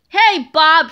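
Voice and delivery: loud, furious call from below; angry principal shouting up the stairs. A narrator's reading. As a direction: bellow it at the kids. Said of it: "shouted Mister Krupp angrily".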